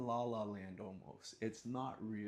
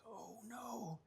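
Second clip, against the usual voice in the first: high, soft mock panic; a touch of fear; mock dread; 12 semitones higher, 8 dB quieter than usual. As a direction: gasp oh no as trouble nears. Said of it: "and I'm like".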